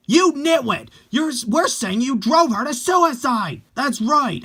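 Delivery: high, loud insulting shout; furious yelling. Acted. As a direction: scream the blame at him. Fans yelling at the boyfriend.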